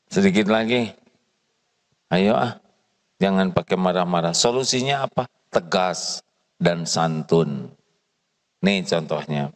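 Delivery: normal talking voice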